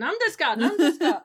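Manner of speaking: Japanese tourist. Acted.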